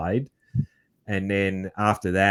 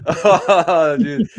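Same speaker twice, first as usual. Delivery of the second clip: laughing; 9 semitones higher, 5 dB louder than usual. Real, not acted. Laughing at the guest's accent.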